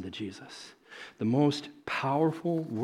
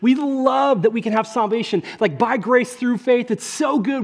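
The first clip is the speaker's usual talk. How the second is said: rising fervor; 9 semitones higher, 7 dB louder than usual. Real, not acted. Sermon building to praise.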